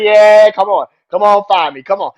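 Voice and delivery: loud, high goading yell; taunting shout. Retold or imitated. Seth taunting Riddle back, loud mock challenge. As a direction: egg him on, shouting. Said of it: "He's like".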